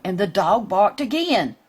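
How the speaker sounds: plain reading voice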